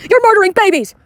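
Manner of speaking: high, loud mocking shout of a street protester; mock protester yell.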